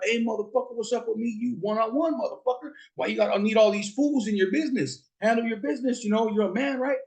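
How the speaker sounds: high-pitched mock yell at Legend; retold yelling. Cousin taunting Legend outside the club, high mock yell. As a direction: call him out like a street challenge. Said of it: "So my cousin's yelling at him".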